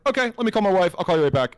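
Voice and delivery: quick and easygoing; a light imitation of the seller hanging up; voicing seller.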